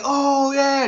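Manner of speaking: high, loud cheering "Oh, yeah"; crowd cheer yell. Retold or imitated. Imitating Super Bowl crowd cheering, high and loud. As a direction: whoop it like the whole crowd. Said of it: "I don't know why I be yelling".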